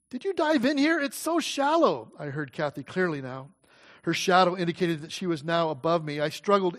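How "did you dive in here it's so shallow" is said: much higher, puzzled and alarmed; startled big-sister question; surprised sister. A narrator's reading.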